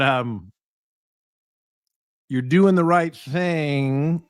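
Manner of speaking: normal talking voice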